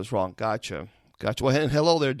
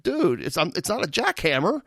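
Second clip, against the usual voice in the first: mock protest to therapist; 7 semitones higher, faster than usual. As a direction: retell the pain with mock indignation.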